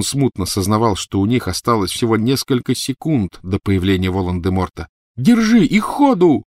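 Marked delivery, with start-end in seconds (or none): 5.2-6.4 high, loud urgent yell; barked command. A narrator's reading. Boy throwing his friend a wand to escape, barking. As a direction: bellow the order to flee. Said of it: "рявкнул он".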